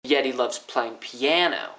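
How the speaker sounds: slow, saving the peak for "piano"; focus on PIANO. Shown as a demonstration. Teacher demonstrates focus on parts of sentence, stressing PIANO. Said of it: "put focus on the three different parts".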